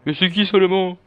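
high, loud put-on demand; mock interrogator shout. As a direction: yell the question like a hammed-up interrogator. Acting out the interrogator's question.

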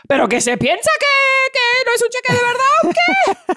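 shrieking offense at being doubted; indignant customer. Retold or imitated. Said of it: "she goes".

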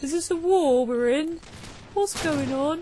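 high, puzzled voicing of a clueless player; lightly voiced bewildered player; dopey confused player. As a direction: play the lost newbie, wide-eyed and baffled. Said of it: "stand there like".

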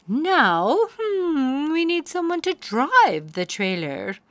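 put-on kindly old magical mare; fairy godmare. A narrator's reading. Fairy godmare greeting crying Poniella, high and grand. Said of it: "the fairy godmare said".

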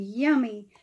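ordinary delivery, nothing marked

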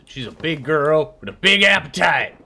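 put-on voice for a pet bear; big bear girl voice. Player shows how his new bear talks, put-on character voice. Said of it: "she talks like this".

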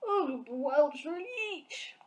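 high, slow, drawn-out giant howl; roaring giant. A narrator's reading. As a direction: howl it out in disgust. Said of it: "roared the blood bottler".

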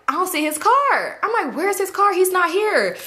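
high-pitched baffled complaint; acted alarm. Retold alarm at missing car.

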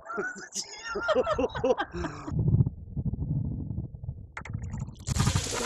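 high breathless laugh from cold shock; shocked breathless laughter. Real, not acted. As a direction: laugh through the freezing shock. Just drenched in ice water.

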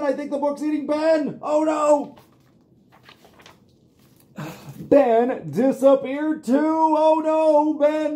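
high, loud storybook shock; dramatic alarm. Acted. Storyteller shocked that the book ate the dog. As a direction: gasp it out high for the kids.

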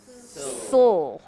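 Sul, one quick syllable; sul demo. Shown as a demonstration. Teacher modelling Sul for the class. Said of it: "you're just gonna do sul".

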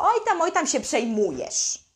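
imitating a parent who shrugs off the problem; mock dismissive brush-off. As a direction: wave the child's hurt away with a scoff. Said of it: "nie wolno ci zaprzeczać, że nic się nie stało".

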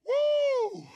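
high joyful woo; ecstatic whoop. Real, not acted. Preacher whoops in praise mid-sermon.

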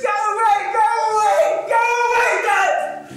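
put-on high, loud panicked child screams; boy screaming at his father to go away. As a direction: scream at dad to leave, terrified. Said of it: "I just keep screaming this".

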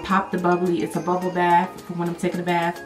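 ordinary talk, nothing marked

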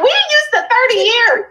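high, laughing mock protest; laughing disbelief. Buyer hearing a house price, high laughing disbelief. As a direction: laugh it off as unheard of. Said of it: "You're like".

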